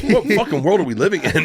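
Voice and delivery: amused disbelief, laughing it out. Retold or imitated. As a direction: crack up while asking it.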